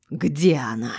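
restrained angry roar; narrated bellow, kept low. A narrator's reading. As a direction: demand it with some force. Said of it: "заревел он".

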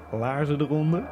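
ordinary talk

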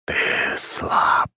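unvoiced spirit voice; ghostly whispered accusation; otherworldly whisper. A narrator's reading. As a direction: whisper it like a voice inside the head. Disembodied familiar's voice in head.

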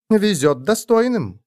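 серьёзно. A narrator's reading. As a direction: answer a little boaster gravely, unhurried. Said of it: "серьёзно ответила маленькому хвостуну эльфийка". (серьёзно и неторопливо)

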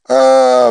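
very high, loud pained howl. A narrator's reading. Joseph howls as he is struck, in pain. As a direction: howl under a blow. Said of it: "вопил Джозеф".

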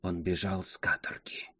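low, soft sinister whisper; ominous whisper. A narrator's reading. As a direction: breathe the secret ominously. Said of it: "зловещим шёпотом сказала она".

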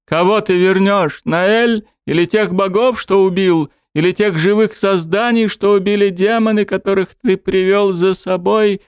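high furious sobbing yell; angry tearful shout. Acted. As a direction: hurl every accusation, crying. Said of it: "зло вперемешку со слюнями и соплями кричала Элина".

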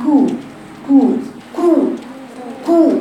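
كو with barely rounded lips; lazy damma. Shown as a demonstration. Teacher imitates a careless damma. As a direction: say كو lazily. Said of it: "ضم الشفتين بتكاسل شديد".

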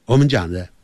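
ordinary talk, nothing marked

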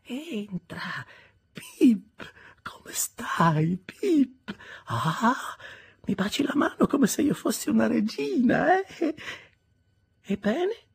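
put-on high, breathy old woman's voice, starting in a whisper; an eccentric old lady teasing the boy; old Miss Havisham. Miss Havisham greets Pip, high and whispery, teasing.

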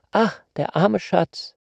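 put-on young girl's high cry of pity. Young girl notices the prisoner's wounds, high pitying cry. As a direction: cry out high, aghast and sorry for him. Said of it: "stieß einen Schrei aus".

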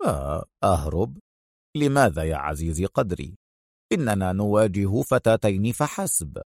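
a smiling hero shrugging off danger; calm and smiling. Acted. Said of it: "ابتسم أدهم في هدوء". (بهدوء مبتسم)

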